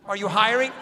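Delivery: normal talking voice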